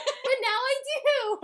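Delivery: high, very loud, laughing cheer; elated. Real, not acted. Host finally understanding a client's joke, elated shouting. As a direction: crow with delight, bursting into a drawn-out yay.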